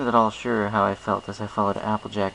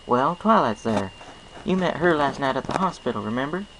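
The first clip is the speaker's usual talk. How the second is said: folksy farm pony, friendly and sure; Applejack; 6 semitones higher than usual. Acted. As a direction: play a cheerful country mare with a twang. Applejack's voice in story.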